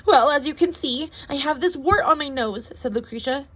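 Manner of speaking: fussy complainer. Acted.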